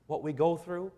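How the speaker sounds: normal talking voice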